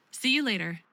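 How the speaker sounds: ordinary talk, nothing marked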